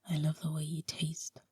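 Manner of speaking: soft intimate whisper, breath only. Acted. Husband whispers a secret compliment to his wife, hushed whisper. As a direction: breathe it into her ear. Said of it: "I whisper".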